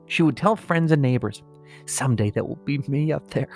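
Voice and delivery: normal talking voice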